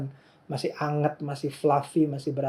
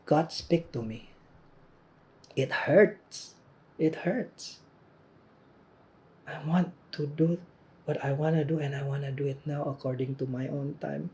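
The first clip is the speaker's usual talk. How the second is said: pleading prayer; 2 semitones higher than usual. Acted. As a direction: pray it like someone hurting and impatient. Preacher voices his own struggling prayer.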